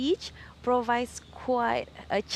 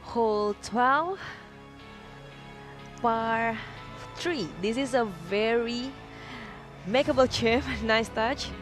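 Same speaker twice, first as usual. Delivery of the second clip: golf commentator; 2 semitones higher than usual. Acted. Sponsored hole feature read.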